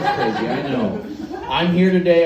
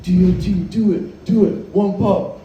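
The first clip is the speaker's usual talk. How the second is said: mock crowd chant, louder and higher; egging on; 6 semitones higher, 5 dB louder than usual. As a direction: goad them like a rowdy group. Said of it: "they're all egging me on".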